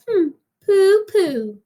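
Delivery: put-on child's unimpressed pooh-pooh; scoffing. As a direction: scoff at the tiger like a fearless little girl. Madeline scoffs at the tiger.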